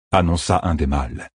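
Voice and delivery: ordinary delivery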